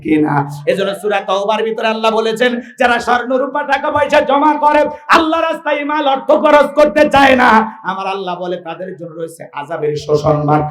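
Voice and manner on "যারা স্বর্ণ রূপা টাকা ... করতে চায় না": high, ringing scriptural warning; full-force preaching. Acted. Preacher relays God's warning to hoarders, high and impassioned. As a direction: declaim the warning to the crowd.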